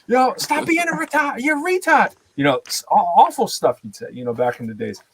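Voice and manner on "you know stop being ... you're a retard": much higher-pitched imitation of heckling; hammed-up high scolding; mock outraged exclamation. Acting out old heated slang.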